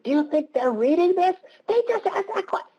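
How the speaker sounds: very high-pitched, squealing mock excitement; excited bloggers. Bloggers realising the lawyers read their posts, excited squeal. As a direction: imitate giddy online fans in a squeaky put-on voice.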